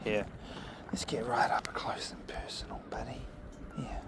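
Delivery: hushed whisper right up close. Real, not acted. Whispering close to the dog.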